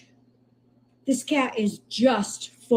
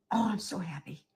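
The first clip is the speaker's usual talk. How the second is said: soft whisper; 2 semitones lower, 8 dB quieter than usual. Real, not acted. Rescuer moved by kitten.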